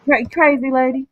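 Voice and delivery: ordinary talk, nothing marked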